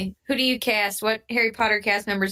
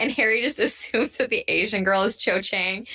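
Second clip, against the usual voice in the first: ordinary talk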